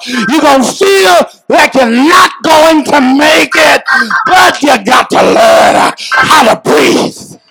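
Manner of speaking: high, impassioned sermon cry; full-force preaching. Real, not acted. Preacher warning congregation about hard times, preaching at full force. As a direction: drive it hard, stretching the key words.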